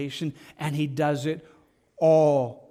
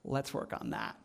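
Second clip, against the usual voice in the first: gentle whisper; 4 semitones lower, 12 dB quieter than usual. Retold or imitated. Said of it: "he's like".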